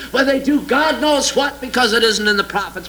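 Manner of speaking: ordinary talk, nothing marked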